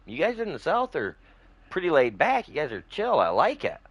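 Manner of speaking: retelling his praise of the South, high and enthusiastic; eager compliment. Retold or imitated. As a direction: gush it to him with your voice way up. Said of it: "I was like".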